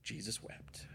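very soft, near-whispered verse; hushed. Real, not acted. Preacher repeating the short verse to the congregation, hushed. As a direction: let it drop to a hush.

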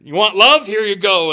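God cheerfully blasting love, loud and high; the Lord laughing big. Pretend. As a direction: hand it out like a fire hose, big and gleeful. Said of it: "And he's just like".

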